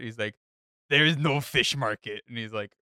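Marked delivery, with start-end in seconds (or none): 0.9-2.2 imitating a French chef, pushed louder and higher; S0 retelling the Ramsay documentary, voicing the French chef loudly; mock French chef. Frenchman chef voiced in retold story.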